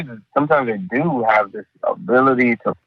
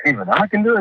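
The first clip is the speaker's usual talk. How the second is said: high, bright mock of a beginner's thrill; lightly hammed-up amazed beginner; excited newcomer; 10 semitones higher, faster than usual. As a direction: squeal it like someone who just found they can.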